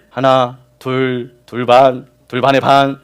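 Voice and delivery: drawn-out playful counting; stalling countdown. Retold or imitated. A father counting to three for his children, drawn out. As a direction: stretch the count to avoid punishing.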